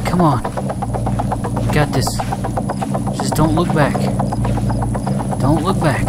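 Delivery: urgent, rattled muttering to yourself under threat; freaking out. Real, not acted. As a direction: keep yourself going while freaking out. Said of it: "I'm freaking out".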